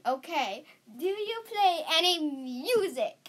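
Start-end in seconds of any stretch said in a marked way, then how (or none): none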